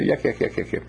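lightly mocked children's yakking; quick hint of kids jabbering; imitated chatter. Scouts who won't hush, imitated chatter.

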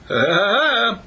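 high, loud mock laugh; teasing laugh. Rabbit teases butterfly about girlfriend, high mocking laugh.

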